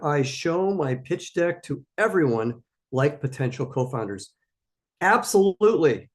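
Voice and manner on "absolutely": Absolutely said loud, high and falling; emphatic absolutely. Real, not acted. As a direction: hit Absolutely hard and firm. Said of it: "I can't say it strongly enough".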